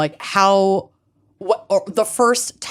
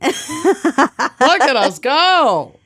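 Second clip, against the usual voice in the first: mock cheer; 8 semitones higher, much slower than usual. Mock cheer about episode.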